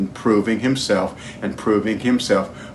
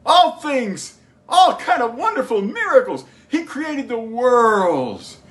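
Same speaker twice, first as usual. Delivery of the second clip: high, ringing preacher's proclamation; full-force preaching; 10 semitones higher, slower than usual. Real, not acted. Preacher proclaiming God's miracles to the congregation, high impassioned preaching. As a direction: draw out the big words, pitched high.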